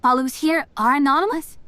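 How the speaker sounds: normal talking voice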